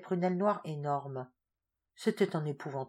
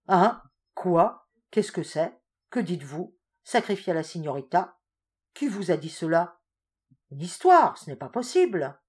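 louder, startled outburst; shocked; 8 dB louder than usual. A narrator's reading. A general hearing of the sacrifice, shocked. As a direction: react in disbelief.

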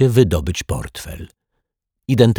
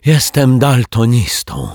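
loud, high, drawn-out theatrical lament; sighing; 6 semitones higher, 6 dB louder than usual. A narrator's reading. Rogue makes excuse to detective, sighing theatrically. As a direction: sigh it out with self-pity. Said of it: "westchnął Martwiak".

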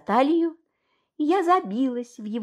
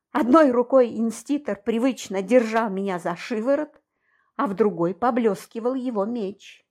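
plain reading voice

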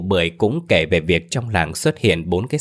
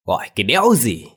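raised, annoyed husband; irritable retort, pitched up; gruff irritated snap; 4 semitones higher than usual. A narrator's reading. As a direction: snap back at being called. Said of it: "lão gắt".

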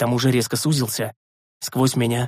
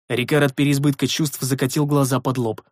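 plain reading voice

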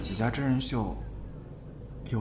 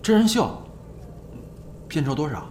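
surprised; 5 semitones higher than usual. Acted. Sudden interest in reality show pay.

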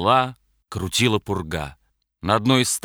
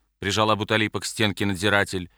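ordinary delivery, nothing marked

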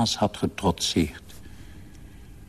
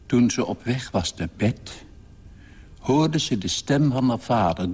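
usual speaking voice

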